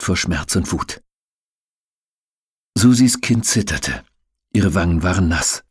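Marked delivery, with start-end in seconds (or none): none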